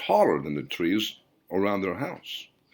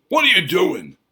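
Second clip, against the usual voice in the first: high, loud angry snarl; furious whispered-shout turned to a yell; 11 semitones higher, 8 dB louder than usual. Acted. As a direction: snap at the old man to put it out. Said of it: "snarled at the old man".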